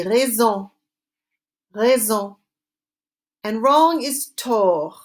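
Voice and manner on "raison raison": Raison with a French uvular r and nasal end. Shown as a demonstration. Teacher modelling Raison for students to repeat. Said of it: "Say it after me".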